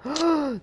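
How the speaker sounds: high startled gasp; sharp gasp. Real, not acted. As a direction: suck in air in sudden alarm. Realizes forgot the ladder.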